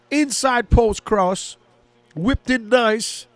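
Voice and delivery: rising excitement in a match call; excited play-by-play. Acted. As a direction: call the attack like a live commentator. Live play-by-play on a chance.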